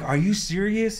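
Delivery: high, disbelieving question; retold amazed disbelief; incredulous. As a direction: ask it as if it's too good to be true. Retold disbelief at good news.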